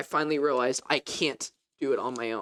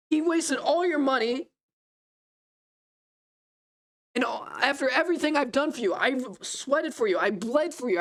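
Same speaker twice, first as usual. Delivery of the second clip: furious yelling; 7 semitones higher than usual. Retold or imitated. Older son yelling at his father, high-pitched furious complaint. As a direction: pitch way up, pour out the grievance. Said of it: "he's yelling at his father".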